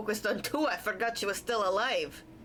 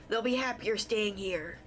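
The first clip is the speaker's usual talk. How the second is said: ordinary talk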